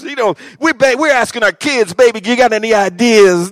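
high, loud, ringing sermon; full-force preaching. Real, not acted. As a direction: preach it hard to the congregation.

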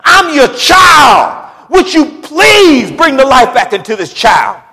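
high, loud, and slow desperate plea to God; shouted plea. Acted. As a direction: cry out to God begging for the child's life. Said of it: "He said".

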